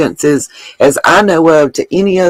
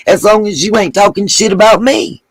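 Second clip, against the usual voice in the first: ordinary delivery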